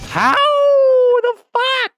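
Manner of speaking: howling high wolf cry; werewolf impression. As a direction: howl it like a werewolf. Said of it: "that was my werewolf impression".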